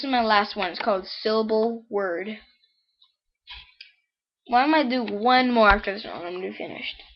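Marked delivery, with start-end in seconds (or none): none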